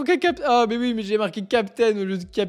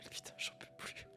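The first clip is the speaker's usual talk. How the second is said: breathless whispered aside, worn out; 26 dB quieter than usual. Real, not acted. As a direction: drop to a tired whisper. Streamer whispers a weary aside. (chuchoté, épuisé)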